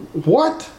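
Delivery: high, puzzled mock reaction; baffled what. Listener reacting to Clint's intro, high and baffled. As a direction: sound thrown by a weird remark.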